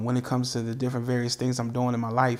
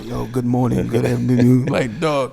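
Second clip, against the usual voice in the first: imitating a friend's deep voice, a bit lower; mock deep voice; 3 semitones lower, 4 dB louder, slower than usual. Host imitating his friend's deep voice, mock deep greeting. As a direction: put on his buddy's deep rumble. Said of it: "was the deep voice".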